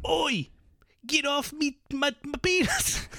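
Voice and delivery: high, exaggerated English yob; mock English accent. Imitating a British passenger on the plane, mock English accent. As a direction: squawk it in a silly English accent. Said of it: "how you do an English accent".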